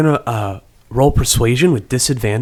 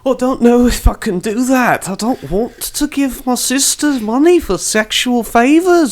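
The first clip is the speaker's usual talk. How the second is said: high, loud, squeamish refusal; high-pitched embarrassed protest; flustered brother; 12 semitones higher, 4 dB louder than usual. Acted. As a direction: recoil in a high voice. JP refusing granny's plan.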